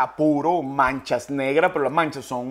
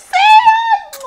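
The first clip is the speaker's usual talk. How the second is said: put-on much higher and louder shrieking girl voice; squealing woman; 10 dB louder than usual.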